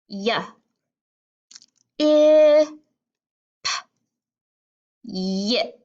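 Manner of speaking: short Y, loud held short I, popped p, then yip; sounding out and blending. Shown as a demonstration. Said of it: "each word on the blending board".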